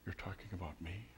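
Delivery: hushed, amazed, as if whispered to oneself; awed inner thought. Retold or imitated. A new believer tasting forgiveness, hushed awe. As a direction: breathe it out in disbelief. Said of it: "they think".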